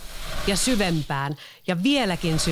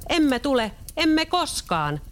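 pigs shouting; 10 semitones higher, slower than usual. A narrator's reading. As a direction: yell defiantly at the wolf. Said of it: "possut huusivat". (possut kiljuvat sudelle uhmakkaasti)

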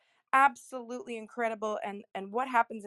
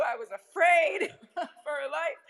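laughing; 6 semitones higher, 5 dB louder than usual. Real, not acted. Laughing while telling story.

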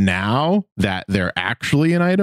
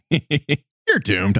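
high, laughing doom line; imitating Jack DeSena; 8 semitones higher than usual. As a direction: tease it like a cartoon sidekick. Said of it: "I can just hear that Jack DeSena delivery".

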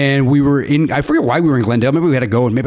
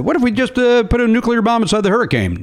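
high, half-hearted Trump voice; lightly imitating Trump musing; Trump impression; 9 semitones higher than usual. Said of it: "where Trump got the idea".

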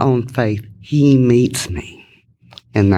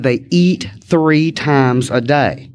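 slow emphatic repeat; slow, spelled-out repeat; much slower than usual. Retold or imitated. To the sponsor who didn't hear, slow emphatic repeat. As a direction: spell it out slowly for someone who missed it. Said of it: "well, she didn't hear me".

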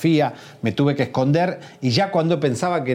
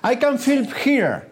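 put-on high, drawn-out English with a Spanish accent; 13 semitones higher, slower than usual. Reporter retelling words to guards, high Spanish-accented English. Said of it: "Viste cómo aprendí bien inglés".

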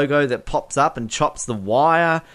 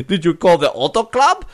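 louder, higher imitated movie villain; put-on character voice, raised and amused; favourite film line; 5 semitones higher, 5 dB louder than usual. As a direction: quote the henchman's line with relish, pitched up and loud. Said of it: "My favourite line of this whole bit is".

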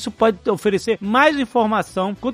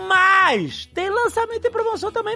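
excited squeal; 12 semitones higher than usual. Acted. High squeal within ad read.

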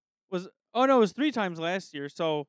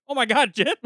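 louder, higher mock shock; retold astonishment; 4 semitones higher, 7 dB louder than usual. Storyteller relives hearing Jim on a friend's phone call, astonished. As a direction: exclaim it as if caught off guard.

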